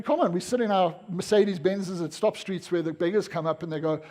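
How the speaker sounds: ordinary delivery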